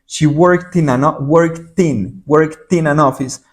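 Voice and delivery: "worked in" run together as "workt-in"; linking words. Shown as a demonstration. Teacher showing how "worked in" links in fast speech, blended. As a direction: join "worked" and "in" into one smooth chunk. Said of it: "termino juntando estas dos de acá porque uno habla rápido".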